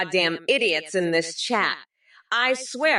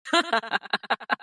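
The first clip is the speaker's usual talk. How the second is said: a brief chuckle after the punchline; short laugh. Real, not acted. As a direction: laugh it off quickly. AI roast voice laughs at own joke.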